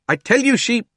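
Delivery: high, loud outburst; excited shout. A narrator's reading. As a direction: blurt it out. Fat man interrupted.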